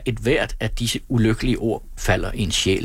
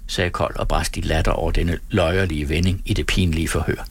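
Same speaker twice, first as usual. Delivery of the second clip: plain reading voice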